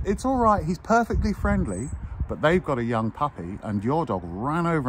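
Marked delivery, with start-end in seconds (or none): 0.0-1.9 higher, sing-song mock reassurance; a hammed-up cheery dog owner, higher; imitating breezy owner. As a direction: play the carefree owner calling out that it's fine. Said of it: "who the owner said".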